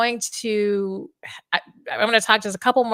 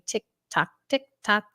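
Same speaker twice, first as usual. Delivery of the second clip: normal talking voice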